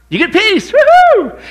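high loud woo-hoo; real high whoop; excited cheer. Real, not acted. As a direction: whoop with joy for the crowd. Cheering over the promised peace.

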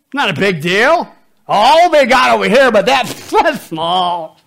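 slow, high, loud mockery; preacher mocking excuses at full volume; loud mocking preaching. Real, not acted. Mocking mature Christians' excuse, loud.

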